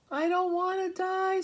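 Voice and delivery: high, loud screaming pig voice. Acted. Wilbur screams at the sheep and Charlotte in panic. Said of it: "screamed Wilbur".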